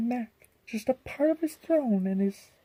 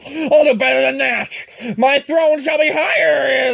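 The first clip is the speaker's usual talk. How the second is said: loud, higher royal rant; thundering king; 4 semitones higher, 6 dB louder than usual. Acted. Yertle thunders at Mac to stay in his place, loud. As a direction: roar down your orders from the throne. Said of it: "his royal voice thundered".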